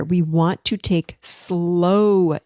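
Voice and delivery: drawn out, very slow; slow demo. Shown as a demonstration. Coach showing the client how slowly to breathe, very slow. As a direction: stretch every word out slowly. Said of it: "Slowly is the key here".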